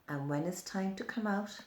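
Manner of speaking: plain reading voice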